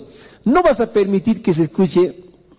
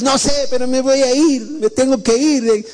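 stubborn whiny youth; 9 semitones higher than usual. Retold or imitated. A preacher voices a youth insisting to his family he must leave, in a higher whiny plea. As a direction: play the sulky boy who won't listen.

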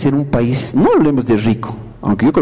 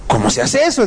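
doubtful questioner; 10 semitones higher than usual. Retold or imitated. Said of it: "dirá alguien".

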